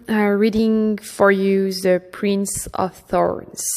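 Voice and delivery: plain reading voice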